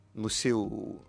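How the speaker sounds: ordinary delivery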